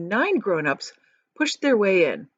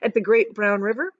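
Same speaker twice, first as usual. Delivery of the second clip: ordinary delivery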